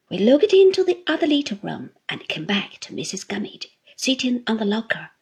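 ordinary delivery, nothing marked

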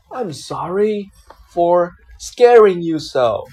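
plain reading voice

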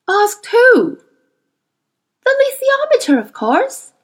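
put-on high, incredulous girl voice; a girl baffled he doesn't know; Lyra, surprised. A narrator's reading. Girl answering the boy, incredulous. Lyra's voice.

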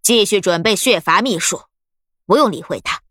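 self-assured order, understated; very confident. A narrator's reading. Old woman ordering her partner to ignore the prisoner, confident. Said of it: "老妪十分自信地说道".

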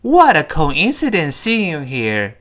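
slow, linked "What a coincidence seeing you here"; read-along model. Shown as a demonstration. Teacher repeating for students, slow model reading. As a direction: draw it out for repeating. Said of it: "再来一遍".

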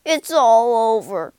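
loud, despairing wail. A narrator's reading. Toad soaked on the canal bank, wailing loudly. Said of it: "he wailed".

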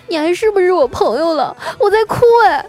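very high, loud tearful protest, hammed up; indignant wailing friend. Crying friend confronts her unmoved pal after a breakup, indignant. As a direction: wail in outrage like a jilted drama queen. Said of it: "她气愤地说".

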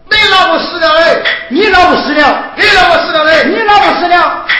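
loud, heated repeated yells; comic quarrel, both yelling; shouting argument. Acted. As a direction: shout it in his face. Heated argument about dead wife.

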